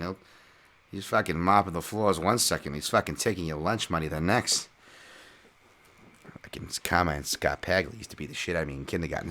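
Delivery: put-on Good Will Hunting style Boston accent. Boston local bragging about the fighter, put-on Boston accent. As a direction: rattle on like a Boston tough guy, R's dropped. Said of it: "my little Good Will Hunting".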